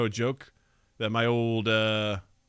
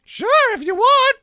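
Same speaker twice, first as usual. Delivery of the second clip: put-on high, loud innocent kid; chirpy young boy agreeing; little boy voice; 7 dB louder than usual. Boy agreeing to close his eyes, high child voice. Boy answers Yoko's request.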